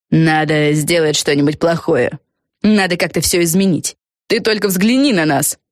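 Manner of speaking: understated zeal, building as it goes; mildly fervent. Acted. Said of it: "С жаром начала Фелисити". (с лёгким жаром, всё настойчивее)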